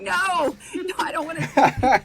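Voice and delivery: high, loud laughing "no, no"; mock childish refusal at the call; laughing protest. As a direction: whine through a laugh, refusing. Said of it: "And I'm like".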